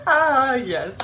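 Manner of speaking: high, teary laugh-crying, slowing on good morning; tears breaking through the laughter. Real, not acted. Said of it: "I'm crying".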